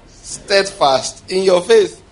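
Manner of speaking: each word stretched out slowly; slow emphasis. Real, not acted. Preacher stressing steadfast faith very slowly. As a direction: lean on every word, draw it out. Preacher drawing out key phrase.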